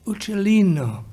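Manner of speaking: ordinary delivery, nothing marked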